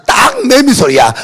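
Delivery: high, loud buzzing imitation of a cicada; cicada buzzing prayer. Shown as a demonstration. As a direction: buzz like a cicada while praying. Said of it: "딱 보세요".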